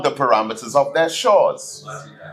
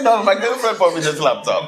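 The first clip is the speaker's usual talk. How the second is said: higher, laughing brazen denial; cheeky denial; 7 semitones higher than usual. Retold or imitated. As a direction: insist with a grin.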